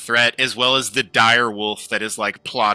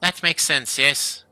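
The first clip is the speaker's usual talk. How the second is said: lightly raised halfling voice, chatty; proud halfling craftsman; 6 semitones higher than usual. Acted. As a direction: talk shop with a fan. Keegan talks about his craft.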